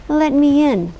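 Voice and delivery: mimicking mother. A narrator's reading. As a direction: call like their own mother. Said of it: "sounded exactly like the girl's mother".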